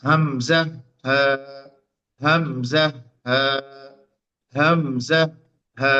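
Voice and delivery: হামজা and হা drawn out from deep in the throat; throat letters demo. Shown as a demonstration. Teacher models throat sounds for students, drawn out. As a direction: sound হামজা, হা from the start of the throat, open and unblocked. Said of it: "এখান থেকে উচ্চারণ হচ্ছে".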